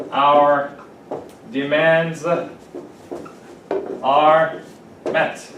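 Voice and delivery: each word separated by long pauses; word by word dictation. Shown as a demonstration. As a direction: dictate slowly so students can write. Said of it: "dictates slowly word by word".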